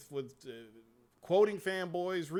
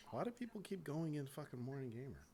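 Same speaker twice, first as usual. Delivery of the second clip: normal talking voice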